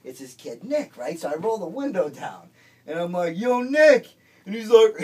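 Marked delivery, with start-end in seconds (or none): 3.4-4.1 loud high shout across the street; calling out. Retold or imitated. Driver shouting to an old acquaintance from the car, loud. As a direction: yell out the car window to grab his attention.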